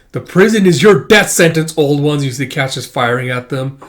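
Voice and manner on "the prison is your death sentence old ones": defiant declaration. Acted. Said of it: "It's like".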